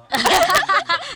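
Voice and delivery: high loud burst of laughter; shrieking laugh. Real, not acted. Laughing at the tongue trick.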